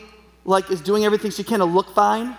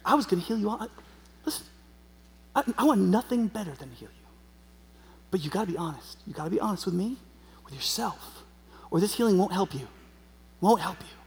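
soft, earnest, gentle correction; gentle Jesus; 8 dB quieter than usual. Retold or imitated. Preacher voices Jesus urging her honesty, gently. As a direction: speak softly, with pauses, to someone you care for.

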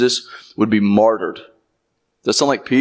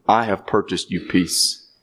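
slow, softer, lower solemn promise; solemn declaration; 5 semitones lower, 4 dB quieter than usual. Retold or imitated. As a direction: lay it down gently as a solemn vow. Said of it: "he's telling them".

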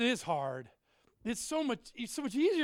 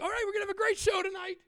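fast, higher showman hype; hyped emcee; 10 semitones higher, much faster than usual. Retold or imitated. Opening his short comedy club set, a fast hyped emcee voice. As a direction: pump up the comedy crowd quickly. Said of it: "I'm like".

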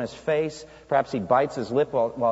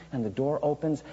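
usual speaking voice

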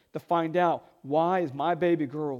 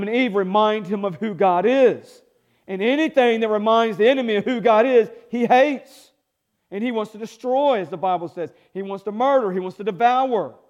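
preaching at full force; 4 semitones higher, 4 dB louder than usual. Real, not acted. Preaching builds against the enemy.

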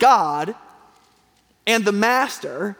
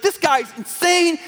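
higher, slow scoffing cry; mock outraged crowd; 7 semitones higher, slower than usual. Preacher mimics the crowd stamping at their feet, aghast. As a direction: play the offended crowd, aghast. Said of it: "they get stamped at their feet".

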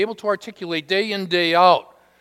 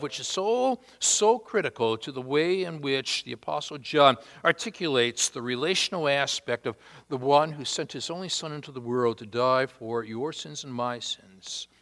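ordinary delivery

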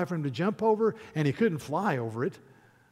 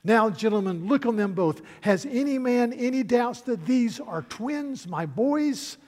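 louder and higher, a king's proud call to his court; proud king proclaiming; 6 semitones higher, 6 dB louder than usual. Acted. King showing his twin sons to the court, loud and proud. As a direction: announce it to the whole court. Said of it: "the king ... said".

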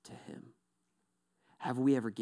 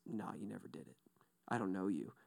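much softer, low and final; hushed lower quiet denial; 6 semitones lower, 14 dB quieter, faster than usual. Acted. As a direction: let the rejection fall gently but firmly. Quoted Jesus denies them.

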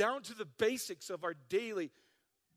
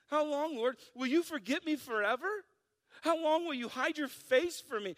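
high, loud whining lament; whiny; 6 semitones higher, 5 dB louder than usual. Acted. Pastor reading David's complaint to God, whiny. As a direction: whine each question as a complaint. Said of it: "read this with a whiny voice".

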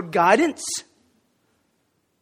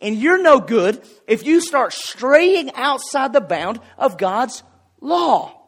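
slow emphasis; 4 semitones higher, slower than usual. Real, not acted. Sermon climax, slowed.

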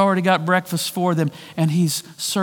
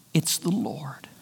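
awed whisper; 4 semitones lower, 9 dB quieter, slower than usual. Retold or imitated. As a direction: whisper it, amazed. Retold recognition, whispered.

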